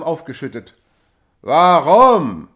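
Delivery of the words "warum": loud call from below; rough call. A narrator's reading. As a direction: shout back up defiantly. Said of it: "rief eine raue Stimme von unten herauf". (rauer Zuruf von unten)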